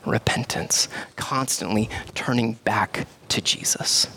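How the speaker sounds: slow, hushed preaching whisper. Real, not acted. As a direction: drop to a whisper to draw them in. Hushed sermon point.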